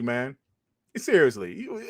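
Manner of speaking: usual speaking voice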